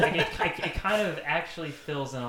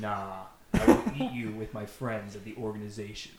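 lightly menacing villain; 4 semitones lower than usual. Acted. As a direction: calmly gloat like a mild villain. Xemnas threatens Sora.

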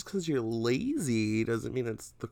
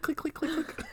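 high mimicked heel clicking; playful clicking noise; heel clicks; 6 dB quieter than usual. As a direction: make the sound of heels running. Imitating heel sounds running out.

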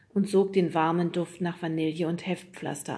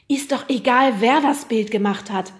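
loud, raised-pitch interjection; calling out; 5 semitones higher, 9 dB louder than usual. A narrator's reading. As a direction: cut in loudly and impatiently. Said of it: "rief Fred dazwischen". (laut dazwischengerufen)

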